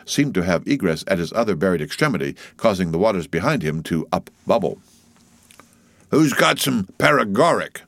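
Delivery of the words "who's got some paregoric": louder, raised second-mate banter; jocular call. A narrator's reading. Stubb jokes to his boat crew, jocular call.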